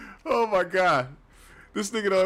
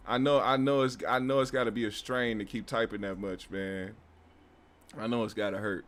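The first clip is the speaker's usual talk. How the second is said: softer, sarcastic pity; mock sympathy; 5 semitones lower, 4 dB quieter than usual. Real, not acted. Streamer teasing a commenter about sore thumbs, soft sarcastic pity. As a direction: coo fake concern at him.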